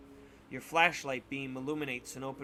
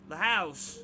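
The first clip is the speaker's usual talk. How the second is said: put-on old man repeating; 4 semitones higher, 5 dB louder than usual. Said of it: "he repeats".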